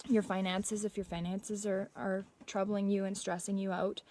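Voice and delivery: normal talking voice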